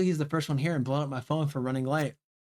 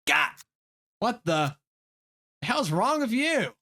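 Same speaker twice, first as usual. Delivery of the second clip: startled; 7 semitones higher, 3 dB louder, much slower than usual. Real, not acted. Startled by friend sneaking up.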